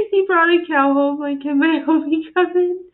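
can't stop giggling; loud laughing delight. Real, not acted. Laughing at dog with cow.

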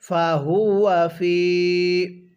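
very slow, drawn-out tilawat; slow recitation. Shown as a demonstration. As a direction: chant it slowly for students to repeat. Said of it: "আরেকবার পড়ুন". (ধীর তিলাওয়াত)

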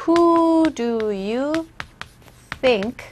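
very slow, stretched-out "Who do you think"; word by word. Shown as a demonstration. Teacher breaking down the phrase literally, very slow.

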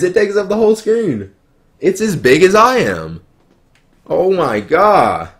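high, loud amazement at the giant screen; amazed. Real, not acted. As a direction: gush over how big it is. Huge iPad fills the screen.

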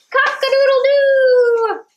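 put-on high, loud rooster crow for the kids; loud showy rooster call. Shown as a demonstration. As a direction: crow out like a rooster waking the farm. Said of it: "That's a fun sound".

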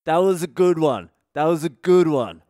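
loud tipsy approval, punched on "good"; mock a drunk guy hailing a joke; sauced-up Jersey guy. Drunk Jersey guy congratulating the comic on his joke, loud and tipsy. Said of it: "was pretty sauced up himself".

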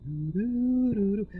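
high sung clarinet doo-doos; imitated clarinet. Patient hearing music as he drifts off, mimicking clarinet. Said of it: "clarinet music".

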